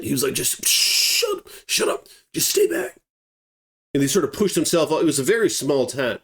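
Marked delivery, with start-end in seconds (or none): 0.3-3.0 imitating an urgent whisper; hushed. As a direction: whisper it sharply to silence a companion. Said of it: "so they're very quiet".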